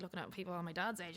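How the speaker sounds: usual speaking voice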